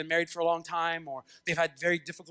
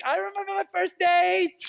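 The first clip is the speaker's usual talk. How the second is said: put-on gushing girl; 10 semitones higher, 4 dB louder than usual. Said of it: "Some of you girls are like".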